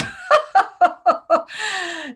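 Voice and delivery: loud, high mock belly laugh; big gloating stage laugh; hammed-up laughter. Operatives gloating over who believed them, hammed-up laughter. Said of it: "go home at night laughing".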